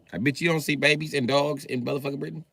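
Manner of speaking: normal talking voice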